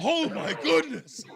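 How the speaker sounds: loud, high, drawn-out astonished outburst; shocked amusement. Real, not acted. Radio host floored by caller's story, shocked and amused. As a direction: burst out slowly, on the edge of laughter.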